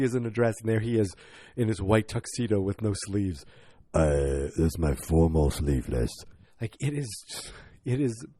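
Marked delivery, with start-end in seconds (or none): 3.9-6.1 much lower, deadpan action-star voice; Vin Diesel impression. Star presenting his sleeveless wedding tux, low mock voice. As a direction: say it low and proud like a tough guy showing off.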